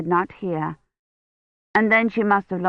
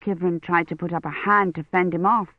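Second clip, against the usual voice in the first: ordinary delivery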